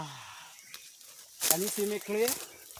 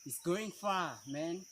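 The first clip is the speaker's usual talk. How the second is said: ordinary talk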